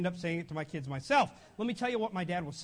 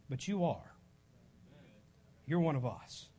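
low, quieter reassurance after a pause; reassuring father; 6 semitones lower, 4 dB quieter than usual. Acted. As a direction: drop low and assure the child he belongs. Father reassuring son after loud contrast.

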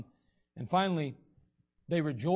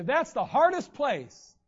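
slow, whiny protest, higher and louder; mock complaint; 6 semitones higher, 5 dB louder, slower than usual. People excusing themselves from their hometown, in a slow mock whine. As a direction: drag it out like a reluctant excuse.

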